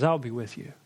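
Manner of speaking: lightly voiced God, low and steady; God's calm reply. God answers Moses again.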